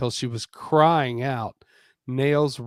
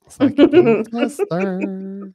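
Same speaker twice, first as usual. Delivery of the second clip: higher, louder playful echo; 8 semitones higher, 9 dB louder than usual. Shown as a demonstration. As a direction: toss the line back playfully. Joking repeat, louder and higher.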